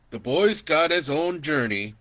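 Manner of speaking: lightly voiced calm uncle; uncle's voice. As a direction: settle it gently as the uncle.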